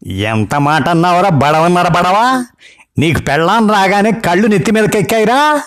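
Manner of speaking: an outraged granny letting rip; furious grandma. Acted. Grandma scolds her grandson nonstop, high furious old-woman voice. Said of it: "బామ్మగారి తిట్ల Bandi".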